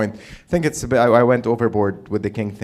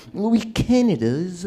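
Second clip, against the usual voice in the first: higher, drawn-out snooty royal drone; mock a strained, snooty monarch trailing into noise; snooty king; 7 semitones higher than usual. Comic mocking the king's speaking, snooty strained voice. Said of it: "so he can be snooty more".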